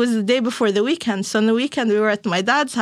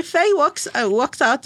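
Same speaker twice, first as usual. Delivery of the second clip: usual speaking voice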